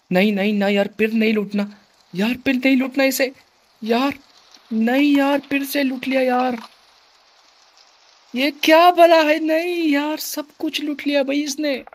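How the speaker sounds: high, loud anguished protest; real frustration, voice up high; dismayed outcry. Real, not acted. Looted again, dismayed outcry.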